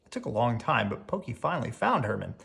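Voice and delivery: ordinary delivery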